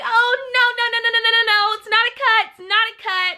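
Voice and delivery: high fast panicked no no no; rising panic. Retold or imitated. Realizing the cut is bad, high panicked repeating. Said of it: "I was like".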